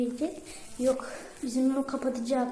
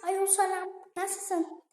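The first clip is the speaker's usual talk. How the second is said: put-on high falsetto girly greeting; girl voice; 7 semitones higher, 4 dB louder, slower than usual. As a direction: fake a girl on the phone. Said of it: "Kız sesi yapacağım". (kız sesiyle selam)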